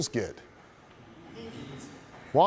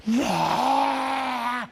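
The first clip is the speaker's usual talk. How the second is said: long, high roaring cheer; drawn-out scream, pitched way up; screaming crowd cheer; 11 semitones higher than usual. Retold or imitated. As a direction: imitate a crowd erupting. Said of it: "Screaming at the top of their lungs".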